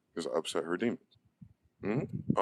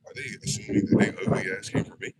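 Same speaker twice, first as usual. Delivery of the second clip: ordinary delivery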